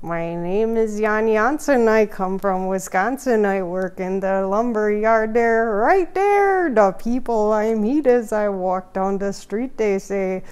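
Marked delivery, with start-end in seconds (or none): 0.0-6.7 louder hammed-up Wisconsin lumberjack voice; sing-song Wisconsin local intro; Wisconsin accent. Vlogger playing a Wisconsin lumberyard worker, Wisconsin accent.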